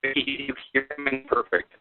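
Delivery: usual speaking voice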